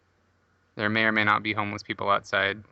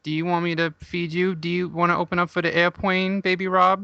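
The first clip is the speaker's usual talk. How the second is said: put-on baby talk; 6 semitones higher than usual. Mock-feeds the host like a baby.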